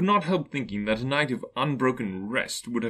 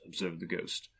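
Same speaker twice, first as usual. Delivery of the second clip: ordinary delivery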